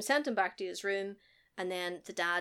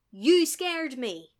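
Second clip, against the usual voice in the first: loud, drawn-out dad scolding; acted-out scolding, slow and raised; mock angry yell; 4 semitones higher, 7 dB louder, much slower than usual. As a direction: stretch it out, loud and higher, as an imitated cross dad. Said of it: "flies off the handle and yells at the kid".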